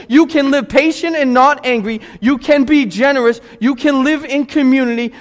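loud, high, emphatic preacher; full-force preaching. Real, not acted. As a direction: drive each promise home with rising force.

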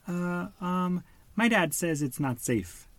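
nervous high stammer; hesitant. A narrator's reading. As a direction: hold back, unsure. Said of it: "Nemo said".

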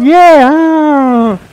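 a retold congregation shouting back; congregation yell. Congregation yells back at the pastor, mock cheer. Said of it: "and everybody yells".

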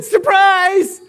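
high, loud joyful shout of surprise; spring it on them like a grand reveal; booming surprise. Acted. God calling out to the praying wife, loud and high.

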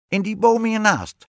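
high, loud witch voice; emphatic storybook witch; bossy witch. A narrator's reading. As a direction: direct them sharply in a piercing witch voice. Witch points queen to the tree.

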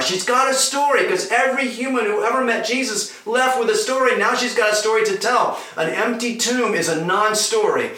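high, driving sermon voice; impassioned preaching. Real, not acted. Preacher to congregation at the main point, impassioned preaching.

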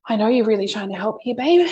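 overwhelmed mum snapping at her daughter over a cup of tea. Retold or imitated. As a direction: cut her off, exasperated. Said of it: "I yelled at her".

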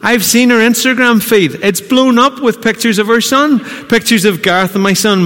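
put-on bubbling over about the baby pictures; excited, high and loud. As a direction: raise the voice with delight. Excited talk about the baby photos.

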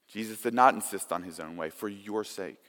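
ordinary talk

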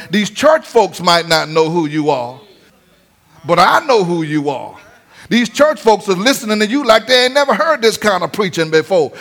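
ordinary talk

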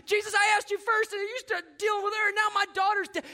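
hurt father crying out at Jesus; frustrated outcry. Acted.

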